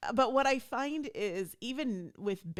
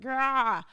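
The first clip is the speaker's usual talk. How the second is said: loud, higher mock growl of annoyance; impatient growl; 6 semitones higher, 8 dB louder than usual. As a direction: let out a frustrated "grah". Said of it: "I'm not patient".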